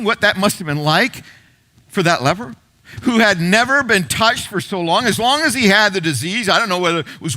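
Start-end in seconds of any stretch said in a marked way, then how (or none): none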